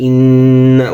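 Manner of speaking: ইন্না with the doubled n held and nasalized; nasal ghunnah. Shown as a demonstration. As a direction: hold the nun in ইন্না long through the nose. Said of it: "ওয়াজিব গুন্না".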